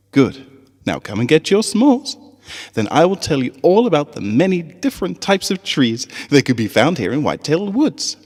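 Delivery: rally the little ponies with a smile; cheerful teacher voice. Acted. Teacher Cheerilee calling her students to camp, bright and high.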